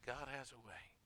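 "God has a way" dropped soft and reverent; hushed repeat. Acted. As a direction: let the echo of "God has a way" sink in softly.

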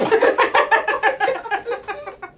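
big burst of high laughter; loud laugh. Real, not acted. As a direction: let out a loud cackle. Big laugh after joke.

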